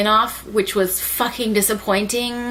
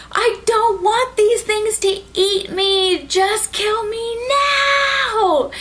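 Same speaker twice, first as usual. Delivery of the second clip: high, drawn-out wailing mock plea; desperate begging; 12 semitones higher, slower than usual. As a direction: wail it, stretching the final word. Retold desperate plea to die.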